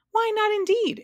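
very high, loud exclamation; exclaiming. A narrator's reading. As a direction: cry it out in a piping, excited voice. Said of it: "exclaimed the bug".

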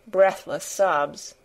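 usual speaking voice

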